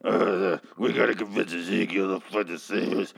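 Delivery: mock Daryl in a Batman growl; low brooding mock Batman growl; deep brooding Batman voice. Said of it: "He's doing Batman voice".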